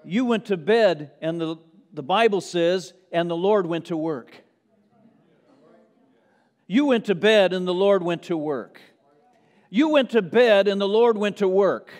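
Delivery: preacher driving the refrain to a peak; building preaching refrain. Acted. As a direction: climb with every refrain.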